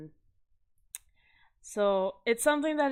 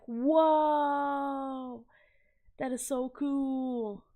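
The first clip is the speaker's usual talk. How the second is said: long drawn-out, raised "whoa", then a slow settle; awed reaction; 4 semitones higher, much slower than usual. Retold or imitated. Retelling a first look at his art, awed. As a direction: marvel slowly, as if seeing it fresh.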